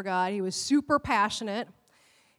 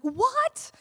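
a much higher, astonished "what"; shocked what; 12 semitones higher than usual. Retold or imitated. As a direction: react as though the spoiler hit hard. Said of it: "if you're like".

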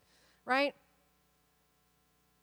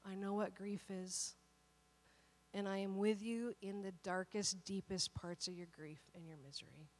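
gentle voice of God; 5 semitones lower, 12 dB quieter than usual. Retold or imitated. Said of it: "God was like".

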